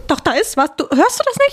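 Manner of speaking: rising panicky pitch, voice climbing high; acted fear. Friend hears footsteps in the flat, panicking. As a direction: play the scared friend hearing footsteps. Acted horror skit, hearing steps.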